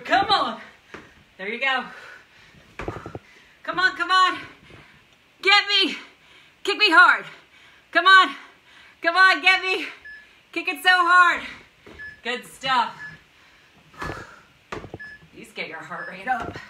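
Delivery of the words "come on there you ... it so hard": high, loud, pushing hard; loud coaching shouts. Real, not acted. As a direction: yell to drive the kicks. Coach urging kicks at her.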